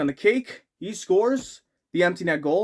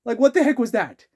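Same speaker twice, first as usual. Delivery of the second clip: faster, louder and higher disbelief; incredulous; 7 semitones higher, 4 dB louder, faster than usual. Real, not acted. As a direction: repeat it even more baffled. Repeats, more incredulous.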